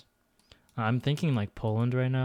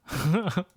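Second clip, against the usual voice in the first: short laugh; 7 semitones higher, 3 dB louder than usual. Real, not acted. Laughing at own accent.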